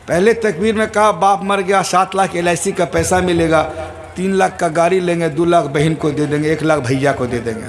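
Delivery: low, quick muttered scheming; lightly voiced greedy son counting the inheritance; a son thinking about money at his father's funeral, low and fast; son's inner thoughts. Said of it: "وہ سوچ رہا ہے".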